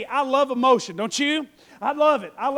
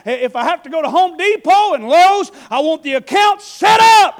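higher, much louder demand; rising shout; 3 semitones higher, 10 dB louder than usual. Acted. Nehemiah demands the account, building to a shout.

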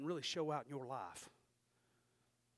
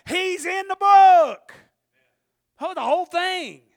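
full-force preaching; 8 semitones higher, 17 dB louder than usual. Real, not acted. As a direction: build it up and up like a revival preacher.

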